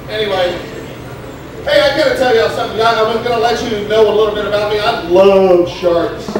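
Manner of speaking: usual speaking voice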